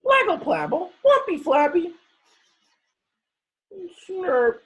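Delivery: put-on high, loud baby babble; toddler babble. Teacher voicing baby Trixie babbling, high and loud. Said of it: "said Trixie again".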